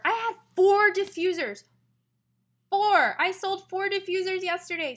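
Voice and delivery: loud, high-pitched bragging outburst; really excited. Real, not acted. Seller boasts of yesterday's diffuser sales, real excitement. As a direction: burst out with real excitement, hammering the number. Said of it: "Sorry, I'm really excited".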